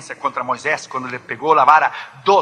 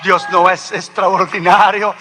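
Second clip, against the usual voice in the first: raised, ringing preacher's voice; emphatic rhetorical question; 3 semitones higher, 6 dB louder than usual. Real, not acted. Preacher repeating to the brethren, emphatic and raised. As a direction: proclaim it to the congregation, building.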